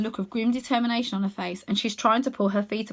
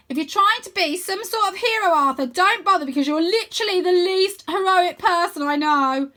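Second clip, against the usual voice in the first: high, loud dismissive scoff; loud teasing sister voice; scornful sister; 9 semitones higher, 7 dB louder than usual. A narrator's reading. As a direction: cut him down. Rose mocking brother.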